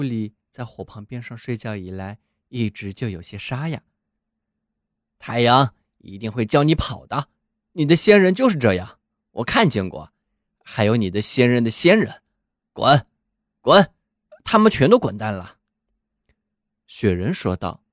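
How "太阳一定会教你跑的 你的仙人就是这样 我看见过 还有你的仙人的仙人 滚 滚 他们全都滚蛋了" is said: put-on loud, hoarse old watchdog; a grumpy chained dog scolding. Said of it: "它有点沙哑".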